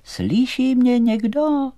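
high, slow drawn-out call; long, loud, high holler. A narrator's reading. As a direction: call into the distance, stretching each word.

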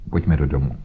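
low-pitched, resolute order; sharp and decisive. Acted. Holmes takes charge of the frightened man, sharp and decisive. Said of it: "řekl Holmes ostře a rozhodně".

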